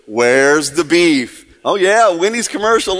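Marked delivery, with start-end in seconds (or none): 0.1-1.4 slow, low ad catchphrase; catchphrase imitation. Quoting a favorite commercial to the crowd, slow mimicked catchphrase. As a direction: draw out each word, low. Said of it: "Remember this one?".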